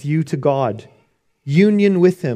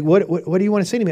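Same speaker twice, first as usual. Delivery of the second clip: fast, pushy repeat; impatient asking; 4 semitones higher, much faster than usual. Retold or imitated. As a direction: rattle it off, getting impatient.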